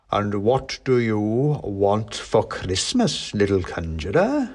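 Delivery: slow, drawn-out Santa voice; ponderous Santa questioning a small petitioner; Father Christmas. A narrator's reading. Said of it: "said Father Christmas".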